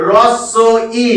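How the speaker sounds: "রস্য ই" with silent h, r first and doubled s; correct pronunciation. Shown as a demonstration. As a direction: sound out "রস্য ই" in parts, pausing before ই. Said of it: "উচ্চারণটা হয়ে যাবে কিন্তু". (হ বাদ দিয়ে, স দুবার করে "রস্য ই")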